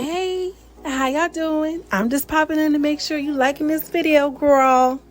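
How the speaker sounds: high-pitched cheerful check-in to the audience; bubbly greeting. Real, not acted. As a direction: pop in with a big cheery hi. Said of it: "pops in to greet viewers".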